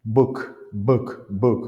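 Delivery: book with a forceful B; vigorous consonant. Shown as a demonstration. Teacher drilling P against B, book with vigorous B. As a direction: punch the B in each book. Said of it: "toujours avec, euh, vigueur".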